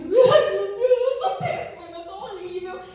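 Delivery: high, loud mock wail; sobbing self-pity after the boss scolds her; wailing self-blame. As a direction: cry it out in despair. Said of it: "Eu olhei".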